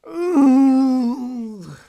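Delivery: high, falling "oooh"; drawn-out playful hoot; long hooted "ooh". Pretend. Sudden hooted noise while rehearsing.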